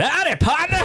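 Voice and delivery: very high, hammed-up cowboy greeting; way overdone. Shown as a demonstration. Showing a friend how to greet, way overdone. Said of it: "I'd way overdo it".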